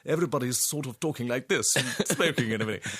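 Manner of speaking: rapid mannered stage-play imitation; Noel Coward. Actor mocking how the plays are usually performed, quick mannered parody. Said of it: "done sort of like Noel Coward".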